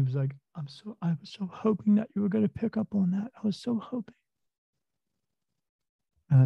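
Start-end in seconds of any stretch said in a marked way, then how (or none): 0.6-4.1 a lightly voiced, higher tearful boy; imitating the nervous kid, pitched up and pleading.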